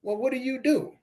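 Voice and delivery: imitating networkers. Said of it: "when these people asking me".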